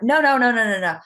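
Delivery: loud, retold protest; insistent denial. Telling her trainee she won't cry, in emphatic mock protest. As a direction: re-enact brushing off the question with emphatic denial.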